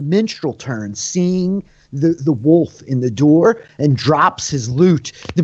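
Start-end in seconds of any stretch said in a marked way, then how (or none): none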